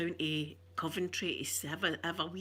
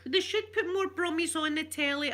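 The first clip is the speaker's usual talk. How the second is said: excited; 9 semitones higher, 3 dB louder than usual. Real, not acted. Gushing about the Brummie accent.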